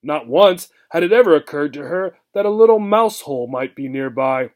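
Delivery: ordinary delivery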